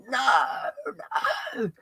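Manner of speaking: high, anguished cry of refusal; acted despair. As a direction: wail it as if collapsing to your knees. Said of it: "she's just like".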